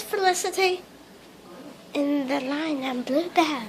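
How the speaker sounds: soft hushed reply; whispering. Acted. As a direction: keep it under your breath. Whispered aside during the play.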